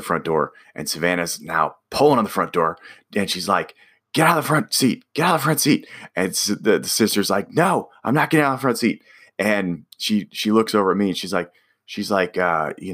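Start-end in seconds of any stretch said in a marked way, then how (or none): 4.1-5.9 high-pitched imitation of a screaming sister; pitched-up mock yell. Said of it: "just screaming at her sister again". 7.5-9.0 high, fast imitation of a squabbling sister; hammed-up indignant retort; mock defiance. Imitates sister refusing in quarrel.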